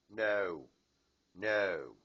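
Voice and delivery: loud, sharp no to a pet; firm scolding no. Real, not acted. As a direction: stop the bird in its tracks. Scolding the bird.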